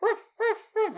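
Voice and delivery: high yapping woofs; dog bark imitation. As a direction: yap like the small dog.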